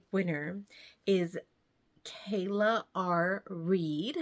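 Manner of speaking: drawn-out name reveal; slow announcement. Real, not acted. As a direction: announce each name slowly. Announces giveaway winner.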